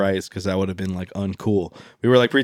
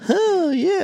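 put-on girly voice. Mimicking mall goth girls.

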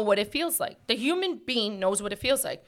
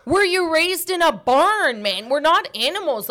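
exasperated retort; 5 semitones higher, 3 dB louder than usual. Retold or imitated. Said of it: "I'm like".

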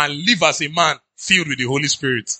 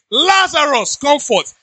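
high, raised calling-out voice; imitating a commanding shout; 9 semitones higher, slower than usual. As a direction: call him out as Jesus, pitched high. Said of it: "said that Jesus shouted".

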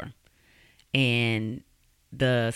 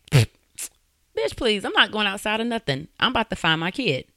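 high, sassy refusal as an imitated mom; defiant retort; 7 semitones higher, 3 dB louder than usual. Mom refusing the operator's order to go outside, defiant. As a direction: push back hard, pitch jumping up.

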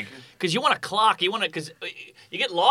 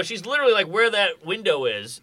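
ordinary delivery

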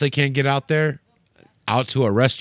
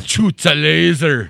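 hammed-up Kano routine; 3 semitones higher, 4 dB louder, slower than usual. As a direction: belt it out slowly in character. Repeats the Kano impression.

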